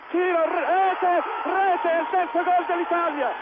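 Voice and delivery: slow stretched goal shout, high and loud; breathless Italian goal commentary. Real, not acted. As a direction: roar the goal out long. Third goal called.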